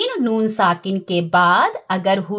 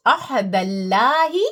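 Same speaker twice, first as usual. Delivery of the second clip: recited again; slower than usual. Shown as a demonstration. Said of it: "पढ़ाऊं आपको".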